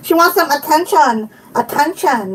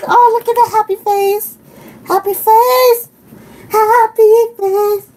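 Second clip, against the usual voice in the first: cooing; 6 semitones higher than usual. Real, not acted. Cooing over cute stickers.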